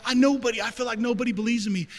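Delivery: put-on young man protesting to God that nobody believes in him; whining despair. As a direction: pour out the hurt in a high rush. Voicing his younger despairing self.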